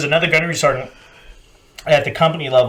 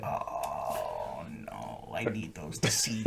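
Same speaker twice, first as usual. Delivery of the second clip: very low, very soft lament; mock helpless murmur; 12 semitones lower, 18 dB quieter, much slower than usual. Marine watching glasses snapped, mock helpless murmur. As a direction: barely voice the defeated protest.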